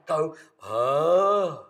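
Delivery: long, loud open ah on the breath; sustained ah. Shown as a demonstration. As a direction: ride the sound on the breath like a surfboard. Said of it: "Try that with me now".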